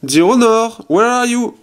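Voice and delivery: calling the phone loud and high, in English; voice command to the phone. Shown as a demonstration. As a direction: call it out clearly so the phone hears. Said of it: "Alors je vais faire". (commande vocale lancée fort et aiguë au téléphone)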